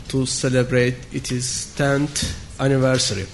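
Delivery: ordinary delivery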